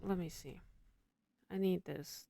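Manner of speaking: normal talking voice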